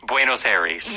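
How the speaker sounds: Buenos Aires as "BWAY-nos AIR-eez", American accent. Shown as a demonstration. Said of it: "American who does not know Spanish".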